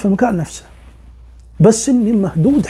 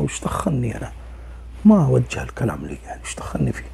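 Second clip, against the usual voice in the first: hushed, breathless whisper, keeping his head down; scared whisper; 8 semitones lower, 9 dB quieter than usual. Retold or imitated. A friend whispering to the storyteller that it's none of his business. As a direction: whisper it so nobody else hears.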